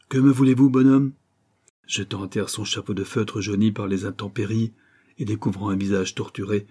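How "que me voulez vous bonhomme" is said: loud, haughty demand; arrogant challenge. A narrator's reading. Banker accosts the old stranger at the gate, arrogantly. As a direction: challenge him from above, loudly. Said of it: "arrogamment, il interpella".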